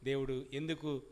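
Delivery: ordinary talk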